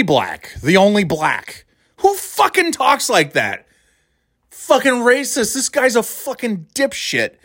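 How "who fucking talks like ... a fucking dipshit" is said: high-pitched incredulous disgust; real outrage. Real, not acted. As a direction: rant in exasperation, pitch way up. Outraged at judge's racist wording.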